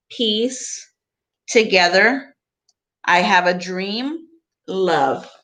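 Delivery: expressive picture book reading; reading to children. Acted. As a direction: read the book to the class with gentle emphasis. Teacher reads picture book aloud.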